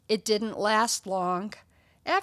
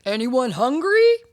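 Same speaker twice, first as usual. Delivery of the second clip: loud offer to a crowd; host calling out; 3 semitones higher, 9 dB louder than usual. Acted. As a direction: call out over a room full of guests. Stanley calls to party guests.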